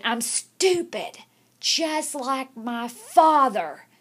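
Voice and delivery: put-on a boy forced to repeat it loud and slow; forced loud confession. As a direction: say it out loud because she made him. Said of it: "Say it like you mean it".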